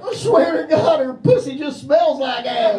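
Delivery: high, loud, slow protest; shouting suspect. Pretend. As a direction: yell your innocence at the cops.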